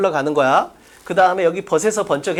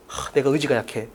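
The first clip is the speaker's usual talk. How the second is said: low, soft sigh of resignation; mock self-blame; 10 semitones lower, 8 dB quieter than usual. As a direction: sigh it out like a discouraged student, lightly acted. 실패한 학생의 자책을 흉내. (힘없이 낮게 자책하는 흉내)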